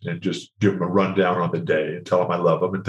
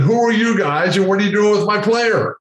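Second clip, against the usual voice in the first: demanding coach; 10 semitones higher, 3 dB louder than usual. Retold or imitated.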